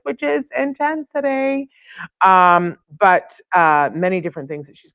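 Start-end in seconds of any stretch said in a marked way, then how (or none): 0.0-1.7 drawn out, much higher and slow, playing up the word; a mock-dramatic flourish; dramatic intensity. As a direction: make intensity sound intense. Names favorite subject dramatically.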